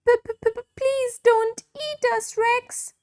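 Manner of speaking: high stammering plea; terrified pleading. Acted. Captured dinosaurs begging Rex not to eat them, stammering in fear. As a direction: beg for your life, stuttering.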